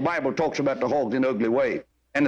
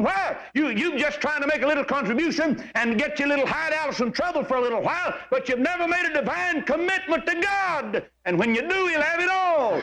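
high, ringing pulpit exhortation; preacher rising to full fervor; impassioned preaching; 9 semitones higher than usual. Real, not acted. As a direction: drive the rebuke home from the pulpit.

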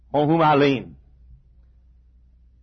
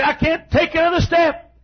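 higher, tired cry; weary complaint; 10 semitones higher than usual. Retold or imitated. Old man quoting himself on the long walk, weary. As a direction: voice a worn-out man giving up. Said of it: "I say".

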